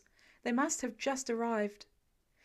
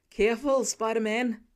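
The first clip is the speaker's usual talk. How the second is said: loud call after him; 6 dB louder than usual. A narrator's reading. Firefighter calling a warning after Spider-Man, loud. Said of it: "one of them calls out after him".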